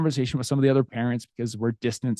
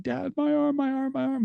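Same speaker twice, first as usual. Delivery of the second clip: put-on much higher, whimpering child's plea; imitating sobbing son; 13 semitones higher than usual. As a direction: mimic a hurt kid crying for dad. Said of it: "He's, like, sobbing".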